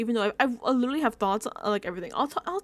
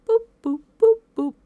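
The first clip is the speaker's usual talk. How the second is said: playful boops, much higher and louder; high boop noises; 11 semitones higher, 9 dB louder than usual. Retold or imitated. Vlogger mimicking her jumpy ADHD brain with high boops. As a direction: bounce out little boops like a scattered mind.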